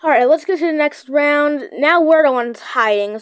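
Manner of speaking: normal talking voice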